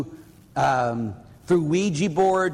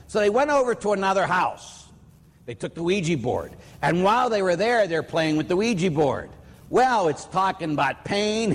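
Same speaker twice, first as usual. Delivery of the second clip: ordinary talk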